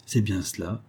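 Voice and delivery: ordinary delivery, nothing marked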